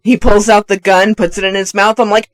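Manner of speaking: usual speaking voice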